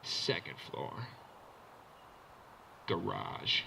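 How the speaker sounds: very low, softened cool-host voice; Exhibit. Retold or imitated. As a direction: show off the car, deep and unhurried. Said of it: "like, Exhibit comes out".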